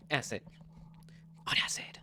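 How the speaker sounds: breathy whispered title. Acted.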